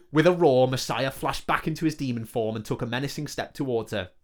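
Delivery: ordinary delivery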